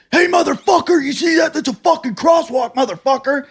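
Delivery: high-pitched imitation of a furious stranger; mock angry yell. Angry man at crosswalk to driver, high mock yell.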